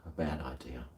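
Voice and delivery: low, soft under-the-breath repeat; muttered echo. Shown as a demonstration. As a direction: mutter it to yourself.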